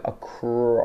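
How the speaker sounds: "acro-" with the R held long; stretched mid-word R. Shown as a demonstration. Teacher drilling R mid-word, "acro-" with a long drawn-out R. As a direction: drag out the R inside "acro-", slowly. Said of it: "To get it mid-word is really hard".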